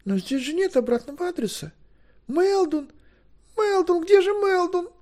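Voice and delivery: very high voice, calling out; high-pitched flustered calling; panicky girl. Acted. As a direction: realise it with alarm, then call for him. Girl panics, calls for messenger.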